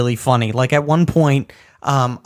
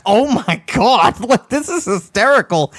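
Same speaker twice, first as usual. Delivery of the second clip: laughing reaction; 7 semitones higher than usual. Retold or imitated. Player reacting to Trevor, laughing. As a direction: laugh through the words. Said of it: "And it's like".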